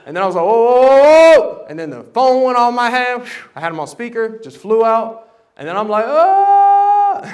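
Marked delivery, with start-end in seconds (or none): 0.4-1.7 loud, higher alarmed whoas; panicked whoa. Retold or imitated. Preacher reliving the spin, loud alarmed cries. Car spinning out of control. 6.1-7.2 much higher, louder startled yelp; jolted gasp of shock. Retold or imitated. Phone flies out of hand, startled yelp.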